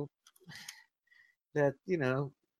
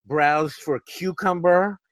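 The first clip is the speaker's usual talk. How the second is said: mock interrogator; 6 dB louder than usual. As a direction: drag out each word of the accusation. CIA interrogator resumes.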